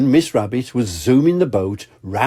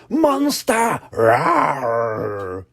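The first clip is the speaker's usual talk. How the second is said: put-on toddler roar; 3 semitones higher, much slower than usual. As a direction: pretend to be the monster. George calling, roaring.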